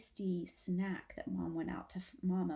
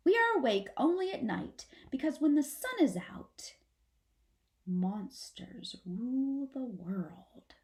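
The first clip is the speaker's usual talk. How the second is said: comforting; 4 semitones higher than usual. Acted. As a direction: build then drop into a hush. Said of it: "make her voice sound comforting".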